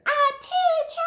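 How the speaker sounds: high, loud cheering; excited class shout. Acted. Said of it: "Everyone responded".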